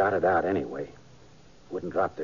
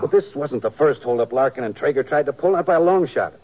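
defensive insistence; 8 semitones higher, 7 dB louder than usual. Acted. Sheriff defends the shooting.

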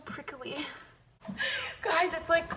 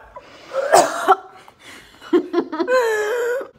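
loud gagging cough with a laugh; dry heaving; 9 semitones higher, 8 dB louder than usual. Real, not acted. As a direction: retch and cough, half laughing. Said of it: "I'm gonna gag".

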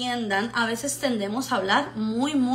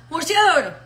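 "murciélago" garbled into one quick blur, higher and louder; hurried, slurred learner's "murciélago"; fast and run together; 6 semitones higher, 5 dB louder than usual. Shown as a demonstration. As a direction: swallow the syllables of "murciélago" in a rush. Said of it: "la dice rápido y lo pega todo".

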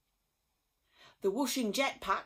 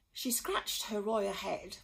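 usual speaking voice

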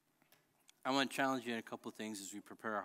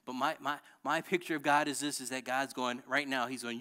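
normal talking voice

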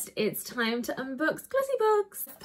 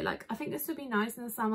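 ordinary talk, nothing marked

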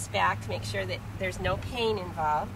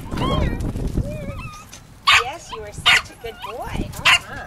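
excited dog praise; 6 dB louder than usual. Real, not acted. Calling the dog to retrieve.

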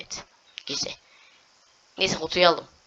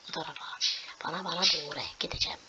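much softer this way; soft coaxing; 8 dB quieter, faster than usual. Real, not acted. Gamer softly guiding the character, whispered. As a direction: whisper the guidance.

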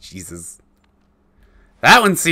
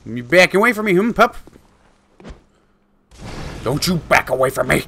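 louder, higher mocking call; taunting shout; 5 semitones higher, 7 dB louder than usual. At the retreating werewolf, loud mock taunt. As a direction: goad the retreating pup.

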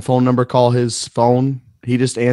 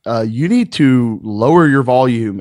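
slow stern; slower than usual. Retold or imitated. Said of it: "I was like".

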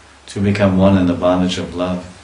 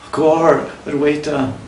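yearning call; 8 semitones higher, slower than usual. Acted. Lovers call across distance.

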